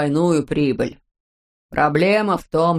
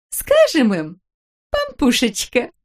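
cooing wife; 6 semitones higher, slower than usual. A narrator's reading. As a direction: coax him sweetly. Wife character voice. (выше, ласково)

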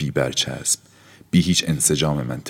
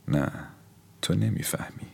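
hushed refusal; 2 semitones higher, 9 dB quieter than usual. Acted. Aura quietly refusing Felipe. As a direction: say it almost under breath.

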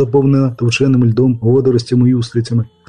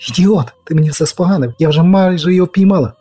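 furious shout; 6 semitones higher than usual. A narrator's reading. Yennefer furious at Geralt.

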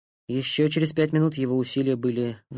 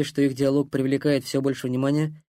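ordinary delivery, nothing marked

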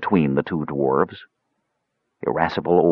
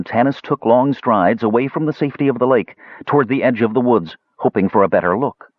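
usual speaking voice

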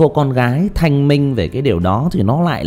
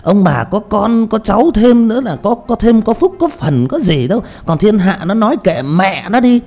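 much higher and louder, a gossipy busybody neighbor egging them on; meddling village gossip butting in; nosy neighbor cheering the parents on, high and loud; 11 semitones higher, 4 dB louder than usual. Shown as a demonstration. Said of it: "một cái thằng hàng xóm".

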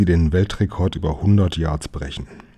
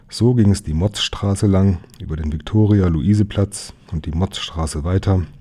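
ordinary delivery

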